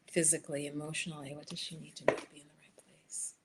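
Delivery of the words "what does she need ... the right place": whispered question, softer and a little quicker. Real, not acted. Tarot reader whispers the question into the deck. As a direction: breathe the question to the cards.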